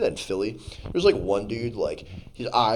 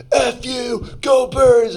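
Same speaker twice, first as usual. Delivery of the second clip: high, loud stadium heckle; defiant fan yell; 13 semitones higher, 5 dB louder, much slower than usual. Retold or imitated. Acted fan yelling at rival.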